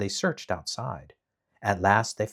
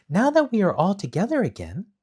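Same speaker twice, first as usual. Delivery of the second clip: put-on girl voice; 10 semitones higher, 5 dB louder than usual. A narrator's reading. Dorothy voiced in read story.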